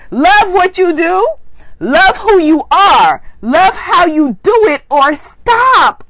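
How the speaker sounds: slow, emphatic, sassy reading with attitude. Shown as a demonstration. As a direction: read each line slowly with sass. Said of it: "read it with some attitude".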